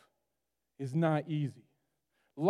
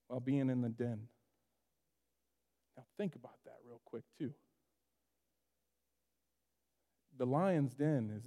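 quiet; 6 semitones lower, 7 dB quieter than usual. Real, not acted. Quiet preaching reflection.

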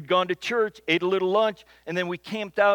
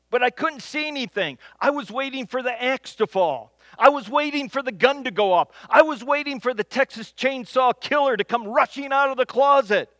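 normal talking voice

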